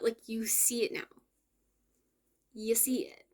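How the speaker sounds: breathy hushed whisper to the viewer. Real, not acted. To viewers watching, hushed whisper. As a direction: lean in and whisper it.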